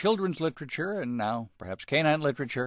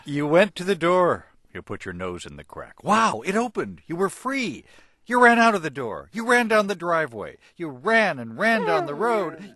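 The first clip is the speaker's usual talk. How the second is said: bright, higher, louder read-aloud; storybook reading; 9 semitones higher, 7 dB louder than usual. A narrator's reading. Host taking his turn reading the dog story, animated. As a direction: read it big for a child listener.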